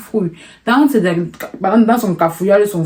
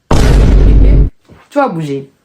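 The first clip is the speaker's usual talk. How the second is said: very slow and much louder threat; menacing bandit voice; 8 dB louder, much slower than usual. Retold or imitated. Robber daring them to move, slow loud menace. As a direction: boom it out slowly, daring them.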